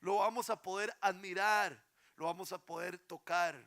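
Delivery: loud, high, ringing sermon delivery; impassioned preacher's call; full-force preaching. Acted. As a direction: proclaim it to the whole congregation. Preaching opening.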